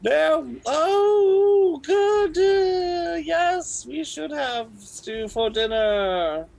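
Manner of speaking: high, loud, slow granny; loud old lady. Acted. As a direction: boom out cheerful nonsense. Deaf old woman.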